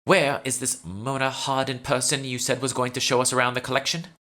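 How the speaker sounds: put-on a demanding socialite in a British accent; haughty British accent. Socialite role keeps British accent.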